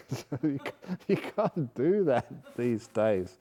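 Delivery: slow, chuckling through it; amused, laughing as you speak; real laughter under the words. Real, not acted. Laughing after a funny story.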